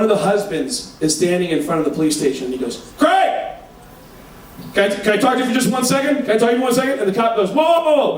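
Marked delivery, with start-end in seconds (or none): 3.0-7.0 high, urgent call to Craig; a husband begging for a word; calling out high and eager, then pleading. Retold or imitated. 7.5-8.2 high, sharp whoa; a cop halting a crowd; cop stopping them. Retold or imitated.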